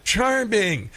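sarcastic sing-song on "Charming."; mocking. Shown as a demonstration. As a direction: drawl "Charming." with mock sweetness. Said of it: "That's really sexist".

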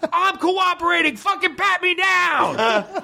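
high, slow, drawn-out shriek; screaming at the top of lungs. Retold or imitated. Retelling screaming at airport security, slow high scream. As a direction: scream it out, stretching every word. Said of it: "I just start screaming at the top of my lungs".

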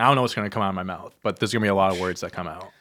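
fast, caffeinated rush of words; words tumbling out wired. Real, not acted. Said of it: "I'm wired right now".

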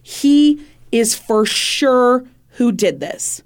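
retold certainty, slowly hammered out; emphatic accusation. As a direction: stretch out "He" and "sure" with conviction.

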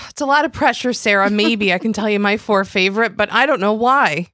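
bright, lightly hammed-up sponsor banter; ad read. Co-host answering the sponsor quiz, bright ad banter. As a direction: play along with the ad cheerfully.

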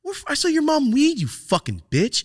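high-pitched, hammed-up angry rant; overacted outrage at the boss; mock angry rant. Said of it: "that's the way I was coming off".

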